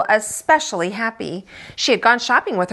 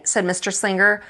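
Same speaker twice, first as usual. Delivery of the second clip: usual speaking voice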